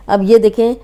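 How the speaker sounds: normal talking voice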